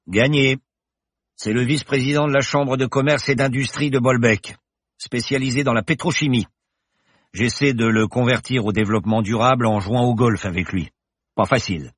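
the confident pilot professor, merry; cheerful professor. Acted. Professor reassures Paloma in the plane, jaunty and loud. Bergton jovial.

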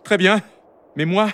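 put-on high-pitched, defiant young comeback; high, headstrong son's retort. A narrator's reading. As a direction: answer back stubbornly in a high voice. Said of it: "rétorqua Rumio".

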